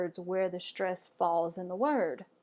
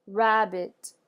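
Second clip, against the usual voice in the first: "rabbit" with RAB louder than the ending; first-syllable stress; 6 dB louder than usual. Shown as a demonstration. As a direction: lean on the opening syllable of "rabbit". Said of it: "the first syllable is slightly emphasized".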